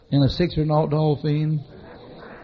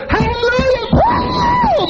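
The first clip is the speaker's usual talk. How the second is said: very high, loud cry of hallelujah; ecstatic shout; 8 dB louder, much slower than usual. Retold or imitated. [name] pounding the dashboard, shouting hallelujah in rapture. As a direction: shout it out, blessed beyond control. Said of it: "balled up both fists and beat my dashboard".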